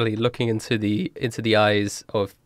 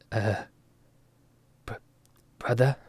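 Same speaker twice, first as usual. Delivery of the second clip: hesitant, much softer question; timid whisper-soft; 7 dB quieter than usual. Acted. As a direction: ask it shyly, barely daring. Louis timidly addresses old man.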